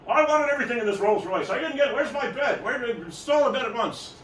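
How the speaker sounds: loud, high rant of a furious customer; hammed-up outraged shouting; acted angry screaming. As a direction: yell at the dealer in mock fury. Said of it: "starts screaming at the guy".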